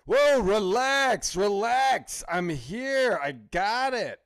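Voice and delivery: slow, loud drawn-out calming of a hothead; Don Cheadle's character. Retold or imitated. Hacker telling his yelling boss to relax, slow and loud. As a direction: talk him down, slow and loud. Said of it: "Don Cheadle's character goes".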